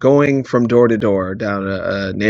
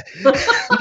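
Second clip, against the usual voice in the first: manic cackle. Retold or imitated. Dafoe finds the baby.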